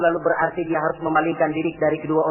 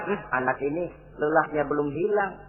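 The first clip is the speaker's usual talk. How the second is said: softer, lower aside to oneself; parent's quiet musing; 5 semitones lower, 7 dB quieter than usual. Acted. Parent thinking about a tired child home from school, softly musing. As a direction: think it aloud, gently lowered. Parent's private concern voiced.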